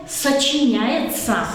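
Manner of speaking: ordinary delivery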